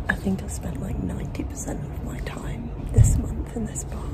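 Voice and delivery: whispered, voiceless confiding; hushed aside. Real, not acted. Performer whispers to the vlog from the bar, hushed. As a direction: keep it to a whisper.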